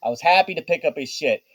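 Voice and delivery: loud, high sudden backpedal; panicked backpedaling. Acted. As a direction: back down fast, scared. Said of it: "Tommy's like".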